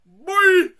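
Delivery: a full-throated yell of frustration; frustrated yell. Real, not acted. At the game after a fail, a raw high yell. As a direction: scream it, very high and loud.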